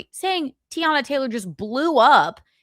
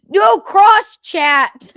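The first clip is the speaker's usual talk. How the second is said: slow, high, loud mock order; mock scolding yell; 11 semitones higher, 11 dB louder, much slower than usual. Host playfully scolding the chat for talking, mock yell. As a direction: holler it as a playful telling-off.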